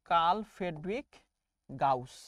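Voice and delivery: "কার্ল ফ্রেডরিক" slow and rising, pause, "গাউস" low; name pronunciation demo. Shown as a demonstration. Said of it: "নাম উচ্চারণ করো দেখি".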